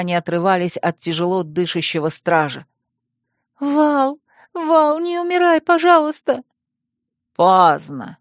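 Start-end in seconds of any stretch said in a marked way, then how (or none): 3.6-6.4 put-on high, pleading girl's cry; desperate pleading. Girl begs dying friend. 7.4-8.2 cruel cut-off. Acted. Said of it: "жестоко оборвал Колин".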